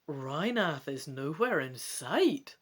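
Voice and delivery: dramatic gamebook narration; story narration. A narrator's reading. Reading the gamebook to viewers, dramatic narration. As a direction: read it out like an adventure story.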